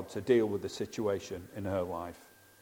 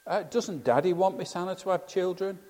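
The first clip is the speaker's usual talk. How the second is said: higher, lightly voiced child; child puzzled about daddy, pitched up; 7 semitones higher than usual. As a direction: ask it like a wide-eyed little kid. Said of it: "one of the children".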